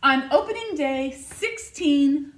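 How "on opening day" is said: plain reading voice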